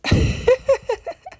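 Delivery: high burst of amused laughter. Real, not acted. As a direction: laugh at your own impression, high and bright. Laughs at own impression.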